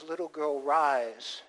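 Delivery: soft, low, gentle bidding; tender. Retold or imitated. Jesus telling the little girl to rise, tenderly. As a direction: coax her awake softly.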